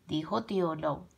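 ordinary delivery

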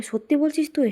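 lightly acted joy with lifted pitch; delighted. Father hearing the good news, delighted. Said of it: "আনন্দ সহায়তা করে বলে".

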